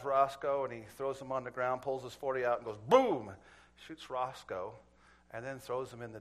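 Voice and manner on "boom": loud mimicked pistol blast; one sudden, punched gun noise; gunshot imitation. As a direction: boom it out like a shot.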